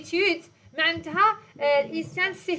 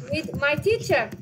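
slow dictation; much slower than usual. Shown as a demonstration. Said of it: "teacher models sentence slowly".

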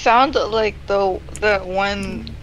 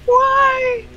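wailing; 14 semitones higher, 6 dB louder, much slower than usual. Pretend. Dramatic wailing over dying.

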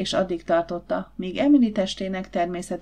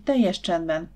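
plain reading voice

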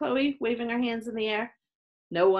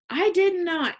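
high, louder denial; indignant yell; 9 semitones higher, 6 dB louder than usual. A narrator's reading. Max denying he pushed Chloe, yelling. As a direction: yell back in protest. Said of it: "yelled Max".

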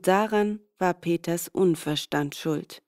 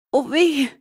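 higher, louder yelp of pain; cry of pain; 8 semitones higher, 6 dB louder than usual. A narrator's reading. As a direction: yelp as the hand burns. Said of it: "schrie er".

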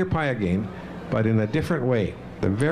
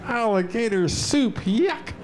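disgusted; 9 semitones higher than usual. Shown as a demonstration. Reciting a poem to children.

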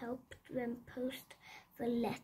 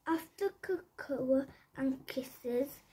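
plain reading voice

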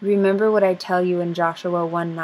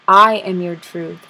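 emphatic declaration; 8 dB louder than usual. Acted. Climactic declaration in poem.

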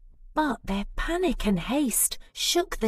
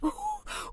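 a squeaky, much higher giggle; 9 dB quieter than usual. Acted. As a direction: let a giggle slip. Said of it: "with a giggle".

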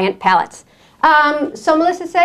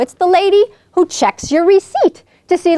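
usual speaking voice

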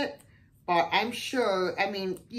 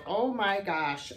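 usual speaking voice

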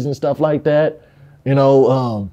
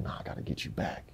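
very low, near-whispered retelling; hushed vow; 21 dB quieter than usual. Retold or imitated. Boy plotting payback for his sister, hushed vow. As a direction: drop to an under-the-breath murmur.

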